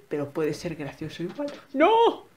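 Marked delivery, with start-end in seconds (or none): 1.7-2.2 high startled no; surprised cry. Real, not acted. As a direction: yelp in surprise. Sudden mishap.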